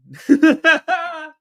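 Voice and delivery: high, loud giggling fit; burst of laughter. Real, not acted. Streamer cracking up after his Sicilian bit, high laughter. Laughs at own accent attempt.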